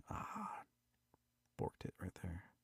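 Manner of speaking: unvoiced muttered whisper; whispered aside. Real, not acted. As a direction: hush it to yourself. Editor's aside.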